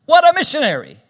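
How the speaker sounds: loud, high preaching shout; exclaiming preacher. Real, not acted. Preacher marvels to congregation at convert's mission, exclaiming loudly.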